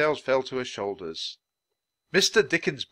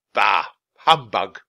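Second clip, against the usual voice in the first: scornful exclamation; 10 dB louder, slower than usual. A narrator's reading. Chapter title as exclamation.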